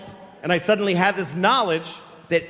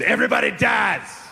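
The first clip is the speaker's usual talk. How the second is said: grandfather's blunt shout; 5 semitones higher than usual. Retold or imitated. Grandfather to a seven-year-old at his birthday candles, shouting. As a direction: blurt it out in a raised, high voice. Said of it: "My grandfather told me".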